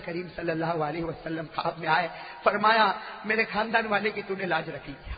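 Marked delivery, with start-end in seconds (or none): none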